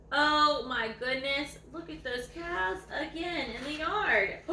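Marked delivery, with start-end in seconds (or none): none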